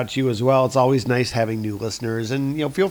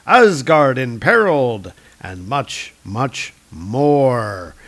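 louder, slow trailer-voice reading; dramatic blurb read; 5 dB louder, slower than usual. Acted. Host reading the comic's cover blurbs aloud, as a movie trailer announcer. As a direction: sell each cover line like a movie trailer.

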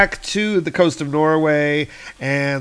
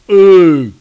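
dorky giant; 6 semitones higher, 13 dB louder than usual. Acted. Dorky giant handed the rope.

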